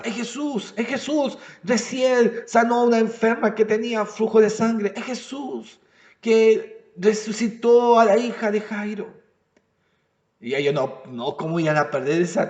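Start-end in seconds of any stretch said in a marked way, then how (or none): 0.0-9.2 higher, softer, eager murmur from the crowd; hushed crowd voice. Retold or imitated. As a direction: pass on the news in an excited hush. Crowd answering the blind men.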